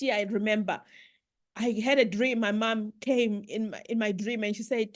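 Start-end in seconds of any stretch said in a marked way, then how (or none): none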